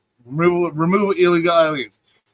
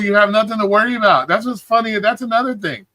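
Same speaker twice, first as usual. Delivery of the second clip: ordinary talk, nothing marked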